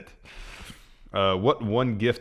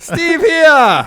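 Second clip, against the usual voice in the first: laughing barker; 11 dB louder than usual. Pretend.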